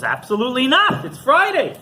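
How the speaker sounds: high, emphatic mock outrage; indignant insistence. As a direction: act out a stubborn, loud denial. Rabbi flatly refuses to be corrected.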